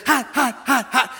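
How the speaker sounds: put-on high little-boy cry of hot; toddler complaining. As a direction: play the child in the hot tub. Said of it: "he said".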